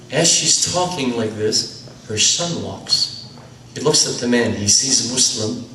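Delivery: usual speaking voice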